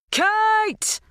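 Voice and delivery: loud, very high bellow. A narrator's reading. Dan shouting for Kate at the locked house, bellowing. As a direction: bellow her name through cupped hands. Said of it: "cupped his hands round his mouth and bellowed".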